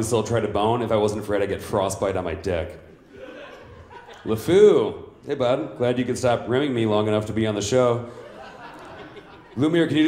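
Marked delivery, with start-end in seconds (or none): 4.2-5.8 loud, high call of "LeFou," falling away; "LeFou," hollered high and loud, dropping at the end. Acted. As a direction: bellow "LeFou," across the room to get his attention. Calling out the sidekick.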